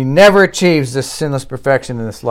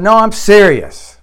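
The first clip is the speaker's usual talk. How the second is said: high, loud, slow insistence; acting out a speaker who is not joking; insistently serious; 6 semitones higher, 6 dB louder, slower than usual. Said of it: "some people thought he was joking".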